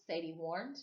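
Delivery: ordinary delivery, nothing marked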